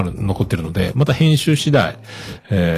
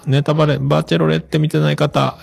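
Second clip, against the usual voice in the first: playful spoiler warning; 5 semitones higher than usual. Pretend. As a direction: call out a mock warning, stretching the phrase endings. Playful drawn-out spoiler warning to listeners. (ネタバレ注意を高く伸ばして呼びかける)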